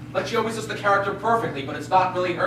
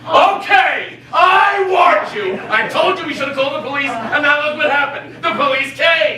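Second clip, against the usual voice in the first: high, loud scolding; shouted recrimination; angry told-you-so; 5 semitones higher, 3 dB louder than usual. Acted. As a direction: burst out accusingly, stretching the warning. Scolding as police arrive.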